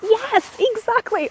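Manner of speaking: high-pitched emphatic yes exactly; emphatic agreement. Retold or imitated. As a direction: squeak out a delighted agreement. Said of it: "I'm like".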